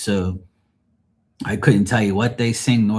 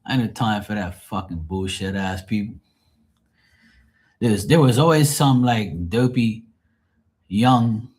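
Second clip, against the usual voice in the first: ordinary delivery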